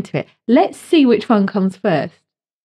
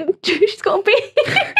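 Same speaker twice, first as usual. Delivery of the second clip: laughing; 12 semitones higher, slower than usual. Real, not acted. Laughing at photo.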